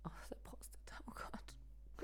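breathy embarrassed whisper. Real, not acted. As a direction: hush it like a naughty secret. Embarrassed joke, said under breath. (verschämtes Flüstern)

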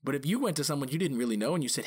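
ordinary delivery

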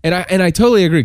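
ordinary delivery

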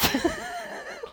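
bright high giggle; a giggle that jumps high; high laugh. Real, not acted. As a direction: laugh at yourself, high and quick. Laughing at own fear.

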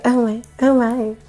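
high, mock-helpless damsel; hammed-up damsel in distress. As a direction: play a swooning damsel up high. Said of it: "Damsel in distress".

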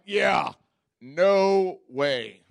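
emphatic refusal. Retold or imitated. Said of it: "I'm like".

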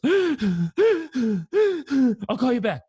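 grandma sobbing into the phone; imitating crying grandma. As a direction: blurt it out through tears, then hang up. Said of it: "grandma's crying that hard".

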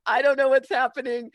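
mock bewildered. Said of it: "mock bewilderment".

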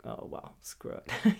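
breathy unvoiced giving-up whisper; resigned whisper. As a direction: toss it away under your breath.

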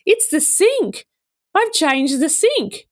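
high, slow reveal of a surprise; proud announcement. Retold or imitated. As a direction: imitate someone proudly unveiling a gift. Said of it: "He's so proud of himself".

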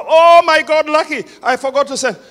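retold midnight alarm. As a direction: gasp in sudden panic. Retold panicked remembering.

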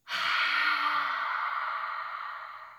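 long breathy dragon exhale; dragon breath sigh. Shown as a demonstration. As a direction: blow out like a dragon. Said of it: "and then breathe out".

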